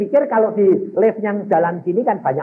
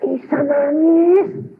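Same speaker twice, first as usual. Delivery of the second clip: mimicked crying; 11 semitones higher, 3 dB louder, much slower than usual. Retelling his mother crying.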